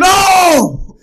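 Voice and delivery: very high, loud startled shout; retold shocked shout. Son's head appears on FaceTime, loud shocked shout. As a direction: jump out of your seat and shout it. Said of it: "And I jumped out my seat".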